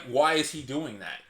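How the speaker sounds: usual speaking voice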